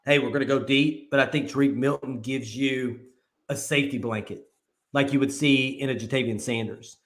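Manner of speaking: ordinary talk, nothing marked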